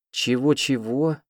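high, slow disbelieving what-what; astonished exclamation. A narrator's reading. Heroine stunned by news of Royce: slow astonished cry. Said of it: "воскликнула".

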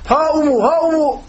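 high, sustained answering call; calling back. Retold or imitated. Prophet answering the Bedouin's shout, high calling voice. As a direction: pitch it high as if answering a hail. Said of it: "O daha sesli bir şekilde bağırıyor".

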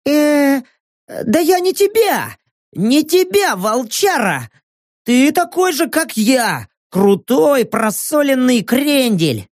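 high, brash swaggering voice; cocky high-pitched tough guy. Acted. Narrator voices cocky Rek.

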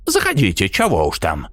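lightly voiced old woman; a hint of a gruff granny; old village woman.

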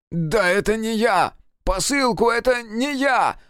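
high, drawn-out desperate cry; shouting in protest. A narrator's reading. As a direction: cry out your innocence, slow and high. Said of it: "закричал Серёжа". (отчаянно кричать, оправдываясь)

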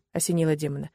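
ordinary delivery

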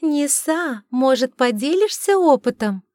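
high, sultry, teasing mermaid; томный и игривый. Acted. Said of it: "томный и игривый". (томно и игриво, высоким голоском)

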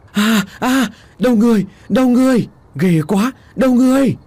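high, loud cries of horror; panicked screaming. A narrator's reading. Villagers seeing a severed head fall, panicked screaming. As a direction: scream out in terror, voice shooting up. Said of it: "thét lên thất thanh".

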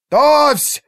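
high, loud bellowed order; barked command. A narrator's reading. Artur readies his line for battle, barked shout. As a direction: bark it out to the troops. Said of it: "рявкнул Артур".

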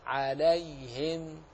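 عليهم slow, with an open lam and still ya; slow model; soft ya. Shown as a demonstration. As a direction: stretch عليهم gently, ya kept still. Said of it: "حرف ليني".

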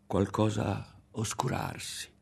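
plain reading voice

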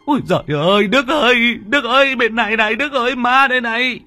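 very high, loud panicked calling; terrified screaming. A narrator's reading. Thắng screaming for his friend Đức, terrified and very high. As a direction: shriek for help in terror. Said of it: "ông rú lên kinh hãi".

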